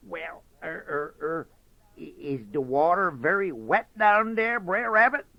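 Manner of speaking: high, faltering fox voice; hesitant Brer Fox. Acted. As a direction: stammer it out, curious fox.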